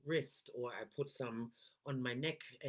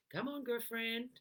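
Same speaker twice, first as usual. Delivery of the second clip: higher, peppy pep talk; 9 semitones higher than usual. Real, not acted. Coach cheering her listener into an upbeat voice. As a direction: cheer yourself on, bright and eager. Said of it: "pick up that voice".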